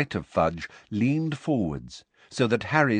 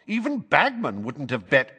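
scornful minister; 7 semitones higher, 4 dB louder than usual. A narrator's reading. Fudge scoffing on.

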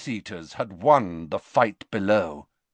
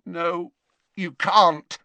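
shaking Malfoy; 9 semitones higher, 4 dB louder, slower than usual. A narrator's reading. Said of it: "his wand hand shaking very badly indeed".

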